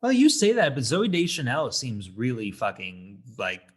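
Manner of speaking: ordinary delivery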